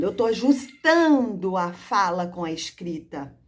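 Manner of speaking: put-on slow and deliberate, as if to a child; teacher's slow classroom voice; slow demo speech. Shown as a demonstration. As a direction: spell it out slowly for the class. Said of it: "o que que tá acontecendo".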